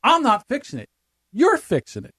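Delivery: high firm father; stern dad. Retold or imitated. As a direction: lay down the law as dad. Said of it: "he says".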